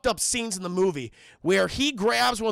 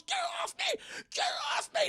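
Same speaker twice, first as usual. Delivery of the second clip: very high, softer squeal; mock choked panic; 6 dB quieter, faster than usual. Acting Parker choked by the robot, mock panic.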